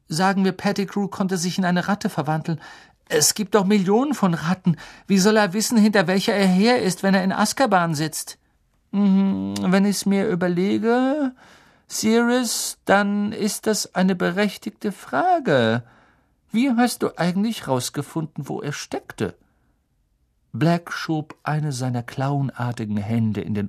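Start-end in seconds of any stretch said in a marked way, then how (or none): 0.1-8.4 put-on high, insistent boy's voice; incredulous youngster challenging adults; sceptical Ron. A narrator's reading. 8.9-19.4 high-pitched, pondering voice; considered, raised-pitch reasoning; thoughtful Lupin. A narrator's reading.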